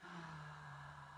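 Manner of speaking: very quiet, long exhale; soft sigh. Real, not acted. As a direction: breathe out softly and slowly. Breathing out during meditation.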